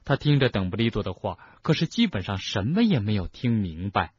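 usual speaking voice

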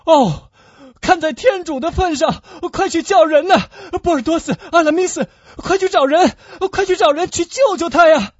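high, loud, frantic screams; despairing full-voiced cries; desperate shouting. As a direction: beg wildly for someone to help. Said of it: "达达尼昂绝望地喊了起来".

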